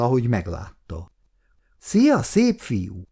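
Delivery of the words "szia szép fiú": flirty greeting. Acted. Flirty colleague greets Péter.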